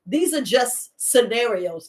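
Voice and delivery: normal talking voice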